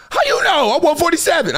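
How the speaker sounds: high, startled woman imitation; mock shock. Woman whose weight he guessed, mock shock. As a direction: squawk it high, imitating her surprise.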